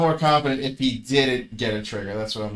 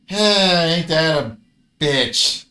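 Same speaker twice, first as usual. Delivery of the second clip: loud, higher exasperated complaint; frustrated outburst; 4 semitones higher, 6 dB louder, much slower than usual. Real, not acted. Gamer yelling at bad luck, loud and exasperated. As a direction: throw it out loud at the screen.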